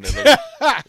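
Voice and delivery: high, loud, breaking into laughter; words cracking into a laugh; laughing while talking. Real, not acted. Laughing at own question.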